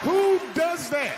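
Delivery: high, slow disbelieving yell; outraged shout. Real, not acted. Comedian outraged at wife opening box, shouting.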